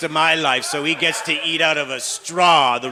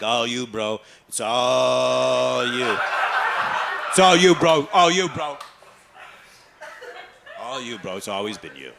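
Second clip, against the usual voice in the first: hyped encouraging gym bro; gym bro spotter; 2 semitones lower than usual. Retold or imitated. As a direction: cheer him on like a bro. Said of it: "they're saying things like".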